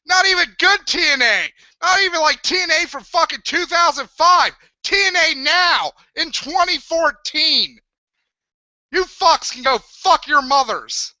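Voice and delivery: angry rant. Real, not acted. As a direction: tear into them, raging. Angry rant at his audience.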